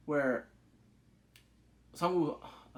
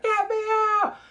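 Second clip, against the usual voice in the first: very high, loud get me out; frantic high yell; mock panicked scream; 9 dB louder than usual. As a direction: shriek it like a fleeing player. Players stampeding for the exit.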